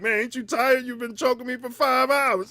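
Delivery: high, tight voice of a man being choked; strained choked voice. Retold or imitated. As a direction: squeeze it out as if held in a chokehold.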